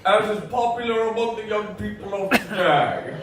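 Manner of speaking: lightly voiced stiff formal gentleman, laughing through it; prim, proper commentary on a sex scene; mock Hitchcock. Comic narrating a seduction in prim Hitchcock voice. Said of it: "thin Alfred Hitchcock".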